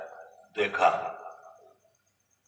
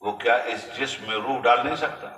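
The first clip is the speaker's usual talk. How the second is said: ordinary talk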